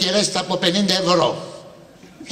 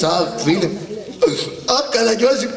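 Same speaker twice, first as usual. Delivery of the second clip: mock mourning wail; 4 semitones higher than usual. Shown as a demonstration. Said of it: "θα λες".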